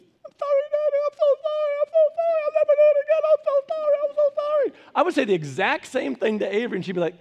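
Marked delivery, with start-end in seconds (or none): 0.0-4.7 put-on high, fast childlike begging; pleading little girl. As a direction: whimper it like a scolded little girl. [name] apologising as child.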